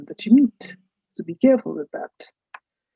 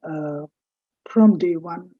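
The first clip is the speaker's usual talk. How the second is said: ordinary delivery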